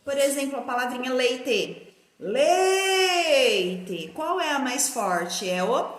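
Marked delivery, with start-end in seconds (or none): 2.2-4.1 long sustained LEI, dropping on the ending; calling out. Shown as a demonstration. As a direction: call the word like summoning a little friend. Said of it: "como se eu tivesse chamando".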